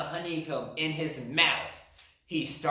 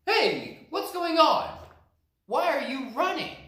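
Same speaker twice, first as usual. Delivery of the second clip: high, loud questioning bear voice; second bear asking; 13 semitones higher, 4 dB louder than usual. Acted. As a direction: call out to the runners. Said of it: "he asked".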